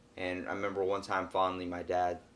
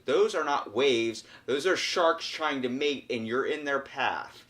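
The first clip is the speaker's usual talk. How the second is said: slow, higher, word-by-word warning; emphatic retold warning; 4 semitones higher, 4 dB louder, slower than usual. Lifeguard warning dad about mating sharks, slow and emphatic. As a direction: spell out the danger slowly, raised pitch.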